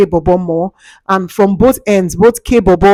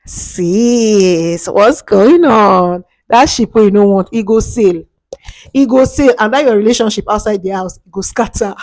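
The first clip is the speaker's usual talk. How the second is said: witch voice. Pretend. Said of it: "Why did I just sound like a witch?".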